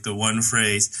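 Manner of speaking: normal talking voice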